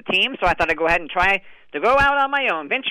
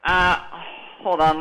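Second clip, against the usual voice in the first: normal talking voice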